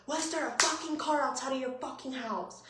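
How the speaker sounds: mildly heated, strained questioning without shouting; retold angry demand. Owner confronting Josh about the car outside, retold angry demand. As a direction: press the question, a touch outraged.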